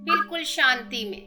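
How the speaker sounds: slow, drawn-out meditation instructions with long pauses; unhurried breathing guidance, lots of space between phrases; guided meditation. Acted. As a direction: lead them gently through breathing and relaxation.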